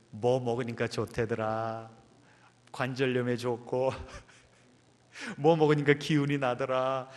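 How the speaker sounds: hint of elders trading health tips; lightly teasing impression of seniors; lightly imitated elders. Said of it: "우리 어르신들".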